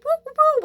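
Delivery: high, excited woo-woo hoots like whistling; happy whistling hoots. Retold or imitated. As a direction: hoot it out happily like a whistle.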